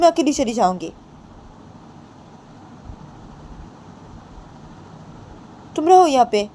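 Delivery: चिढ़कर जवाब देती बहू; indignant retort. Acted. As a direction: throw the accusation back at her. Rama hitting back, offended.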